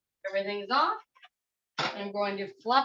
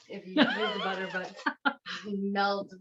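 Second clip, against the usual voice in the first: laughing through words; 3 semitones lower, slower than usual. Real, not acted. Laughs mid-sentence.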